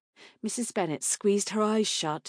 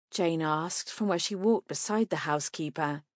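ordinary delivery

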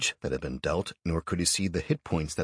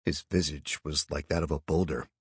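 ordinary delivery

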